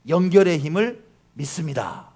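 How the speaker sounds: slogan read out warmly in ad style. Shown as a demonstration. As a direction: deliver 연결의 힘을 믿습니다 as a polished ad slogan. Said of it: "제가 이제 한번 읽어드릴게요".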